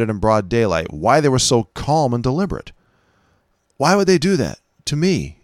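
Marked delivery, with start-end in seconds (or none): none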